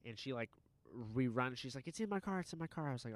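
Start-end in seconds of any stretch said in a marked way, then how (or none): 1.9-2.9 high, urgent retold plea; panicked woman. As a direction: imitate a frantic woman, pitched up. Retold panicked woman pleading for help.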